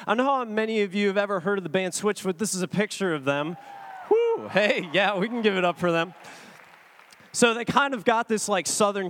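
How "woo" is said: high "woo" cheer. Real, not acted. Speaker to audience cheering a band, high cheer. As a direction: whoop for the band.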